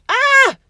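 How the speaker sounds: loud, high storybook shriek; sudden high scream. A narrator's reading. George's false teeth fly out, shrieking. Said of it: "George shrieked".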